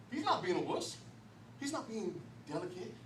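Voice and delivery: ordinary delivery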